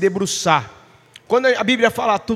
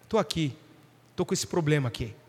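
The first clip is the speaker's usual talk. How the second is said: much softer and lower confession; quiet prayer; 6 semitones lower, 9 dB quieter than usual. Pretend. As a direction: pray it almost under your breath. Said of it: "E falar". (oração bem baixinha e humilde)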